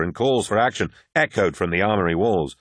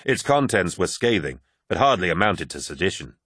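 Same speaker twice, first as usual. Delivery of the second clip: ordinary delivery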